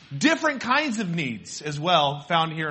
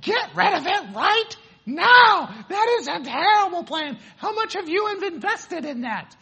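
mom yelling; 11 semitones higher, 4 dB louder than usual. Retold or imitated. Mom yelling at son on phone about bad insurance. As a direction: yell it down the phone like a frantic mom, stretching each word. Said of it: "My mom started yelling on the phone".

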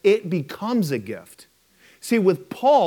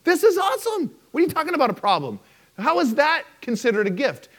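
very high, mock-delighted then baffled; hammed-up fake cheer turning to confusion; high mock enthusiasm; sarcastic crowd; 10 semitones higher than usual. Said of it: "anybody in the crowd would be like".

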